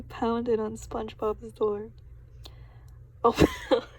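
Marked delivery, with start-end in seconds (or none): 3.2-3.9 very high, loud yell of "Open up". A narrator's reading. Sandy pounding on SpongeBob's door, loud yell. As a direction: bang on the door and shout it. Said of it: "she yelled".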